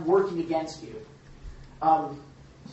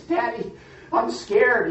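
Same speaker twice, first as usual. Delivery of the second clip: imitating a frightened little girl, higher and louder; scared little girl; 8 semitones higher, 7 dB louder, slower than usual. Little girl calling to her dad as the fire approaches, mock frightened and higher. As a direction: voice a small child crying out in fear. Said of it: "the little girl started to cry".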